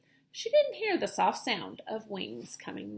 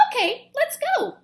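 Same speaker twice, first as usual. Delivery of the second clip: high, bright bird voice; eager agreement; 13 semitones higher, 5 dB louder than usual. Acted. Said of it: "agreed Pip".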